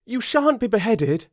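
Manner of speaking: high, emphatic promise; determined Alice. A narrator's reading. Alice protecting the gardeners, determined high voice.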